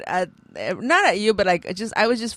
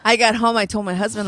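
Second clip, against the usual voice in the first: ordinary talk, nothing marked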